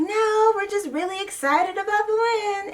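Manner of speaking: high, mock-innocent denial; mocking Janelle. Vlogger mimicking Janelle denying pregnancy, high mock-innocent voice. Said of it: "And she's like".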